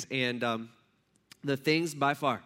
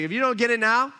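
raised louder and higher, half-preached; exasperated warning; 7 semitones higher, 7 dB louder than usual. Retold or imitated. James confronting his readers, raised exasperated voice. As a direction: push it out like a fed-up teacher. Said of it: "He's like".

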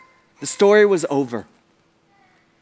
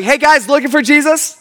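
raised, chipper voice, a bit held back; rehearsed greeting; 6 semitones higher, 4 dB louder than usual. Acted. Angel greeting the women after calming himself, rehearsed cheer. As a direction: try a practiced, friendly opener. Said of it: "he kinda catches himself".

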